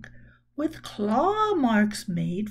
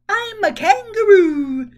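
loud high holler, pitched up; 5 semitones higher, 9 dB louder than usual. A narrator's reading. Rose on a trampoline hollers to her friends, loud and high. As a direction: shout it out bouncing on the trampoline. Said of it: "she hollered".

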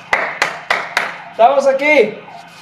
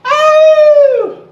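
high, loud celebratory yelp; excited yelp; 6 dB louder than usual. Real, not acted. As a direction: whoop like the set is won. Coach whooping at set end. (grito de celebración)

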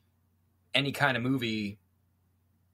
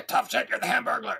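drill sergeant shout; 10 semitones higher than usual. Acted. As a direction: bark it down at him.

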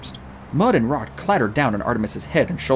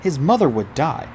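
usual speaking voice

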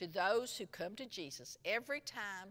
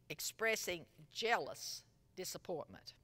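ordinary talk

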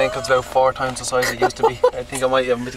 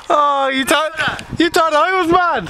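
laughing; 13 semitones higher, slower than usual. Acted. Mock reveal laughing.

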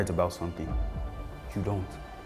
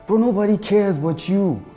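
raised voice; 10 semitones higher, 6 dB louder than usual. Acted. Confronting washed-up actor.

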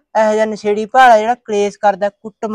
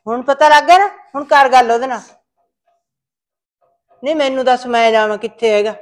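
angry taunt; 3 semitones higher, 4 dB louder than usual. Acted. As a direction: throw it back at him, raised. Mother keeps scolding son.

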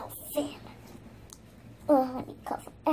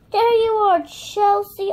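gasp, then high, loud, quick exclamation; delighted discovery; 5 semitones higher, 7 dB louder than usual. Pretend. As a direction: excitedly play at finding someone hidden. Finding hidden Chelsea.

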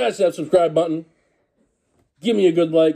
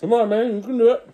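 higher, encouraging cheer; 8 semitones higher than usual. Real, not acted. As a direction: egg him on with a laugh. Cheering friend on.

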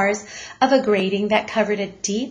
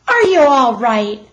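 louder, anxious call; 4 semitones higher, 8 dB louder than usual. Acted. Mother calls to son in the mailbox, loud and anxious. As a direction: call through the box, worried. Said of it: "she called".

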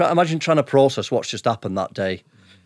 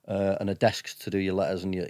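ordinary talk

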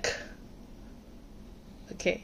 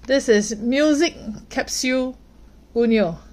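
usual speaking voice

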